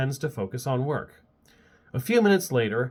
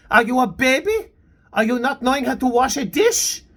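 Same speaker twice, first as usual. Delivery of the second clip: angry scolding; 11 semitones higher, 6 dB louder than usual. Acted. Maëlle scolding, continues bellowing.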